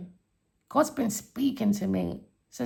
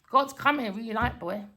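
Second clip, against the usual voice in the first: normal talking voice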